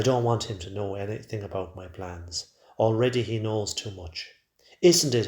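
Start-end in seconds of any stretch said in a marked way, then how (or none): 4.8-5.3 loud, higher pressing question; forceful repeated demand; insistent. A narrator's reading. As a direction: press hard for an answer, voice raised. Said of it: "he insists".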